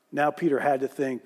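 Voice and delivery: usual speaking voice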